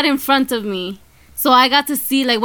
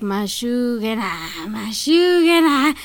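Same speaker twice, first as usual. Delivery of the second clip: slow, eerie sing-song chant; creepy woman's mutter; slower than usual. Retold or imitated. Retelling the woman screaming to herself on the bus, eerie slow chant. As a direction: creep it out slowly like the lady on the bus. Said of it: "her voice stuck out".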